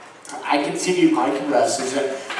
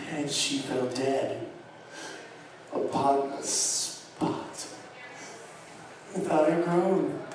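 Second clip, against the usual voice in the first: slow, much softer, almost breathless; softly and slowly, as the wife dies; hushed; 3 semitones lower, 13 dB quieter, slower than usual. A narrator's reading. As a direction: let it fall to a near whisper, slowly. Murder told, voice drops away.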